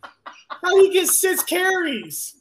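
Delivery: high, slow disbelieving outcry; mock outrage at the stat sheet; incredulous. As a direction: squeal it out in total disbelief. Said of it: "I'm like".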